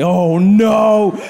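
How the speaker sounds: loud mock dread; dismayed. Passenger seeing a baby board, loud mock dread. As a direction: groan inside as the baby comes aboard. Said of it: "your eyes are like".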